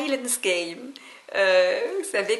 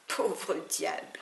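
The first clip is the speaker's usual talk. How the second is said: slow, breathy hushed aside of mock pity; scornful whispered pity; 3 semitones lower, 8 dB quieter, slower than usual. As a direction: whisper it with contempt, as if pitying the poor wretch. Pitié moqueuse pour le faible. (murmuré avec un mépris apitoyé, lentement)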